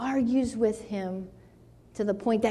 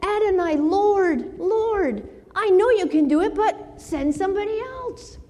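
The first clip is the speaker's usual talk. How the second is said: higher pleading that rushes then trails; 9 semitones higher than usual. Retold or imitated. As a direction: beg with the Lord, hurrying the middle. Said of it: "He's recognized".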